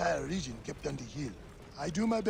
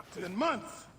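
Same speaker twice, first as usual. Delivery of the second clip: laughing; 4 semitones higher, slower than usual. Acted. Laughing reunion greeting.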